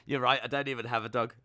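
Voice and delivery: ordinary delivery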